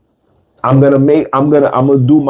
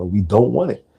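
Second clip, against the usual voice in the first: ordinary talk, nothing marked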